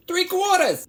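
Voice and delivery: high, loud call; calling out measures. Pretend. Calls out measures while pouring.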